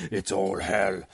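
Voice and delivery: low monster voice; crazy beast. Retold or imitated. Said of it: "crazy-ass beast is like".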